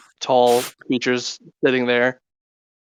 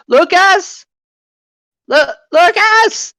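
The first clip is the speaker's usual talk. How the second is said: high shout calling Lucas; 6 dB louder, slower than usual. Acted. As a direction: yell across the building for him. Fairy calls for Lucas.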